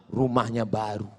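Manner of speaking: low, soft reply; subdued client. Acted. Man naming his neighbour to the tuyul, low voice. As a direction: answer meekly, low and quiet.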